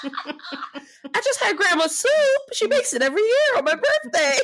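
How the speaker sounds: high, squealing through laughter; helpless giggling, pitch climbing way up; laughing quote. Retold or imitated. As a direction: crack up while voicing the quote. Cracking up while quoting a character.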